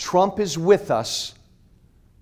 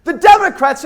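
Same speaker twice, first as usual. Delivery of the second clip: high, loud, angry yelling; furious shout; 11 semitones higher, 13 dB louder than usual. Real, not acted. Speaker shouting at the crowd about the Republican Party, furious. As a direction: rail against the party at full voice.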